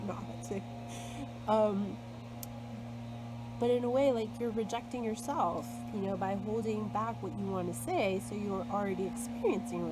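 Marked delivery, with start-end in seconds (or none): none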